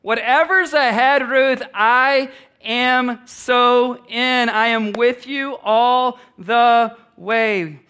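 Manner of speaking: heartfelt drawn-out pledge; fervent promise. Acted. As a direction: stretch every word, all in. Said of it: "She makes this promise".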